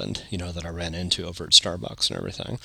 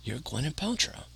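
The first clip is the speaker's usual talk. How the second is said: higher, softer mock insistence; retold insistence; 4 semitones higher, 8 dB quieter than usual. Drunk friend sticking to his mistake, mock insistence. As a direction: lightly imitate a stubborn drunk. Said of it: "And he insisted".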